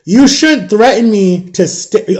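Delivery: slow, pointed, every word set apart; slow firm warning to his son; deliberate, measured. Retold or imitated. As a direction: lay it down slowly like a lesson. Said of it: "I said it like this".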